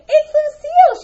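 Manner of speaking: loud, high excited cry; excited shout. A narrator's reading. Arlie spotting the bird, excited shout. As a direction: yell out the discovery. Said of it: "shouted Arlie".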